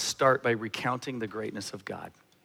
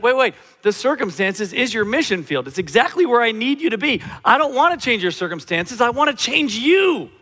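high, loud voice of God correcting; impassioned preaching; 9 semitones higher, 8 dB louder than usual. Acted. God answering a praying believer, in an impassioned loud voice. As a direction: push it out urgently, high and forceful.

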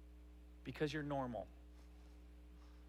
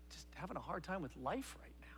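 whisper; 8 dB quieter than usual. Retold or imitated. Voicing a weary believer's thought.

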